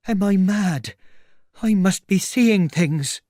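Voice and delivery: high, loud cry of disbelief; alarmed bewilderment. Acted. Tramp finding nobody there, alarmed and bewildered. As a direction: wail as if losing your wits. Tramp doubts his own senses.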